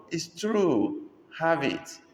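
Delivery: high, insistent seller; lightly voiced seller. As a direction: play the eager seller. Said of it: "The seller responded".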